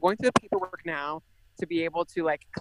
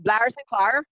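put-on Irish accent; 4 semitones higher, 5 dB louder, slower than usual. Guest shows how the Irish say her name, a light Irish accent. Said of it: "There's also, like".

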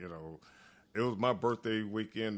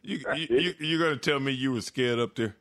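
incredulous; 5 semitones higher, 8 dB louder than usual. Real, not acted. Incredulous question to Limbo.

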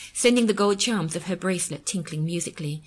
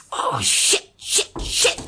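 breathy, louder repeated curses; rising panic cursing; 3 semitones higher, 6 dB louder, much slower than usual. A narrator's reading. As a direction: curse harder at each discovery. Discovers the second flat tyre.

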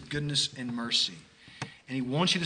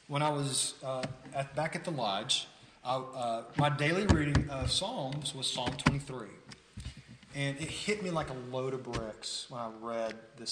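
ordinary delivery

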